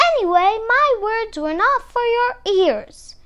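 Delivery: high, loud indignant telling-off; snapping scolding. A narrator's reading. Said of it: "she snapped".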